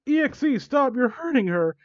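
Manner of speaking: high, loud desperate shout. Acted. She charges and yells for him to stop, high and loud. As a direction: yell at him to stop hurting her. Girl yelling to stop violence.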